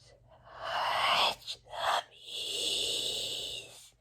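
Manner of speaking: slow, drawn-out ghostly whisper; very slow mystical whisper; eerie whisper. Acted. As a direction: breathe it like a voice in his head. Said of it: "speaking to him through his mind".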